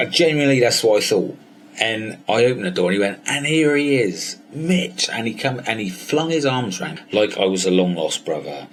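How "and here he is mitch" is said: high, slow showman announcement; grand welcome, drawn out and high; announcing arrival. Retold or imitated. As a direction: present him like a star guest. Mentor announces his arrival.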